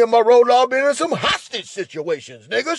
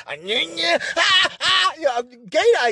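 mock pained cries; 9 semitones higher than usual. Imitating her cries.

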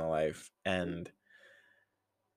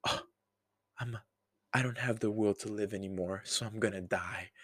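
mock giving-up; 7 dB quieter than usual. Mockingly voices Padme giving up.

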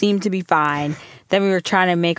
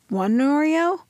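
put-on high little-kid question; 10 semitones higher, slower than usual. Son bargaining for cookies.

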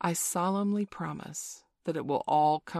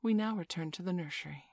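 ordinary delivery

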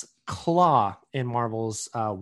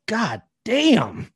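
mock amazed; 9 semitones higher, 5 dB louder than usual. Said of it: "I was like".

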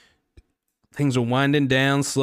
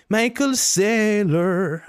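Michael Saylor stretched very slow and much higher; odd put-on voice, very slow, high and louder; drawn-out name; 9 semitones higher, 5 dB louder, much slower than usual. Shown as a demonstration. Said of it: "I don't know why I say that like that".

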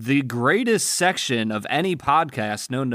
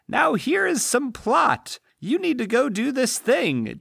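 pitched-up mock Glinda spelling out the plot; 12 semitones higher than usual. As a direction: talk down to Dorothy like a sweet fairy godmother. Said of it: "And Glinda is just like".

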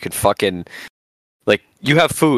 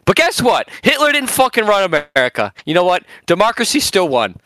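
heated rant; 7 semitones higher than usual. Real, not acted.

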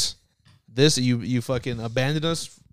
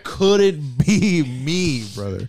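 slow drawl; much slower than usual. Pretend. Meme catchphrase, stretched out.

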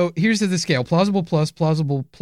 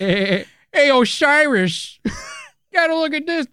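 higher slow cartoon dad; Peter Griffin impression; 9 semitones higher, slower than usual. Cohost doing Peter Griffin as a god, higher. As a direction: drag out the name then blurt. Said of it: "Peter Griffin".

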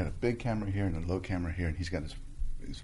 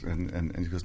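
usual speaking voice

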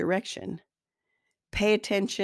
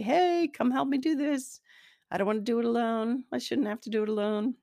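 higher-pitched, plaintive call for help; big girl voice; 6 semitones higher than usual. Acted. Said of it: "use my big girl voice and say".

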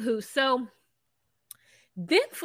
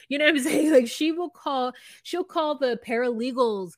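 usual speaking voice

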